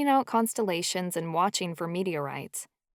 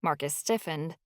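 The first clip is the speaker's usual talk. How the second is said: ordinary delivery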